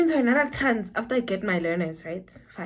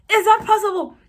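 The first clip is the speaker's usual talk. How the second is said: high, loud disbelieving question; incredulous outburst; 11 semitones higher, 9 dB louder than usual. Real, not acted. Incredulous at failing eye test.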